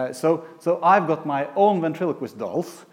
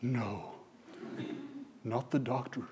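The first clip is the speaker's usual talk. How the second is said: retold boy's answer; 2 semitones lower, 12 dB quieter than usual. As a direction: answer quietly, piece by piece. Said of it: "the, the boy".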